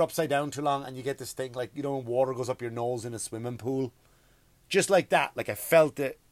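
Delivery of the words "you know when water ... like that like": ordinary delivery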